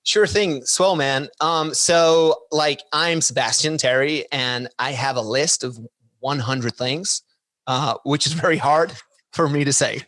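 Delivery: put-on American accent, slightly hammed-up; Australian attempting an American accent. Shown as a demonstration. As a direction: introduce yourself as a mock American. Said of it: "that was my American accent".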